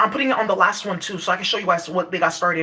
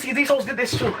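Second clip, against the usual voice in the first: normal talking voice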